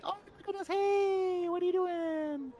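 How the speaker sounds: very high singsong squeal; drawn-out, squeaky mock-friendly greeting; coping by cooing. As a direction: coo in falsetto at something scary. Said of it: "my way of coping with that".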